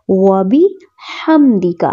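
وبحمدک slow, the ha breathed out clearly; drawn-out وبحمدک. Shown as a demonstration. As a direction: mind the ha in وبحمدک. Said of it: "ہا کی آواز کا خیال رکھنا ہے".

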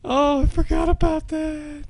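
wailing, very high lament; squeaky, distressed moan; high-pitched whine. Real, not acted. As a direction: whimper it out after a scare. After the screaming panic.

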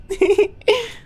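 sudden high laugh; high burst of laughter. Real, not acted. Gamer cracks up at own psychic joke, high laugh.

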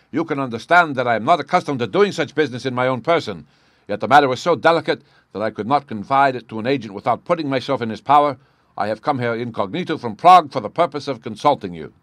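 loud, high regal voice; emphatic, agitated nobleman; imperious king explaining himself. Acted. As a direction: insist urgently on the delicacy of it.

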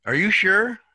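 high, loud incredulous question; mock disbelieving challenge; doubtful are you sure. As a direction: press them as if you can't believe it.

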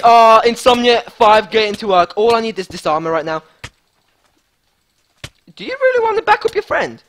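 usual speaking voice

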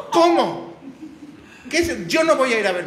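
high, loud scandalized mother; loud, high outraged old mother; mother's shocked outcry. Retold or imitated. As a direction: cry out in high, loud shock like his mom. Mother shocked, hard of hearing.

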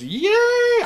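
high-pitched sustained "yay"; mock crowd cheer, way up high; wave cheer. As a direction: squeal out a pretend stadium cheer. Acting out a stadium wave.

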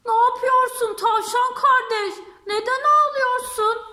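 high, loud, singsong lion voice. Acted. Lion asks the crying rabbit, high and loud. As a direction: call the question out high and bright.

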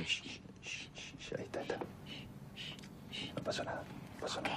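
fast soothing whisper, nothing happened; shushing whisper. Real, not acted. Soothing crying babies back to sleep.

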